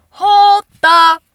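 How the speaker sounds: loud, high, drawn-out interruption; emphatic halt. Pretend. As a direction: stop everything with a big loud call. Calls a dramatic halt.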